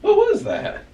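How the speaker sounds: a sudden, very high squeak of alarm; real fright, high and loud; startled yelp. Real, not acted. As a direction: jump at the noise and blurt it out high. Startled by a strange noise.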